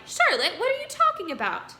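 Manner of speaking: high, loud alarmed question; he can't believe what he hears. A narrator's reading. Wilbur begging Charlotte to explain, high and alarmed.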